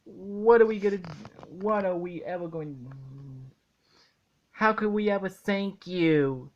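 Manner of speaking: high, eager mock thank-you; a hint of gushing childlike thanks; gushing gratitude. SpongeBob retold thanking Squidward, lightly high and eager. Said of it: "SpongeBob's like".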